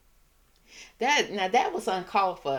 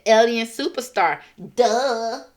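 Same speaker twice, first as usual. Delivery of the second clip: loud, higher mocking emphasis; sassy quote; 4 semitones higher, 8 dB louder, slower than usual. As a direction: spell it out like they're stupid. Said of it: "She said".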